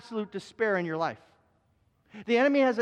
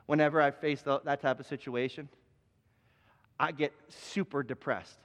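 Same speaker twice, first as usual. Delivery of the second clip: mopey friend; 7 semitones lower than usual. Retold or imitated. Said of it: "And he goes".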